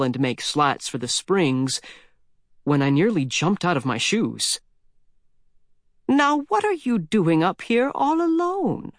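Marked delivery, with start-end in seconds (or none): 6.1-8.9 put-on old lady's voice. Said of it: "It was a human voice".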